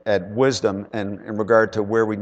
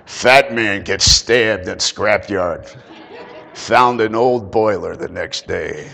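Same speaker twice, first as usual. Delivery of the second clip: mock news headline; 2 semitones lower, 3 dB louder, slower than usual. Imagined headline, slow and dramatic.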